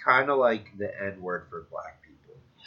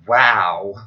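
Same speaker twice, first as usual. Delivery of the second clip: loud exaggerated mock wow; hammed-up amazed wow; 13 dB louder than usual. Heckler's loud sarcastic wow. Woman mocking reaction quoted.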